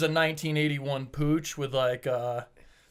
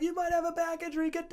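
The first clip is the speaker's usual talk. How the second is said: worried lady; 5 dB quieter, faster than usual. Retold or imitated. Lady warning the limping rider, high anxious urging. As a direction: imitate her fussing in a high pitch.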